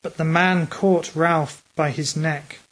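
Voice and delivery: plain reading voice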